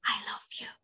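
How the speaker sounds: soft clear whisper. Shown as a demonstration. Teacher demonstrating a whisper to kids, clear whisper. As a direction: whisper it but keep it clear. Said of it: "itu harus terdengar jelas".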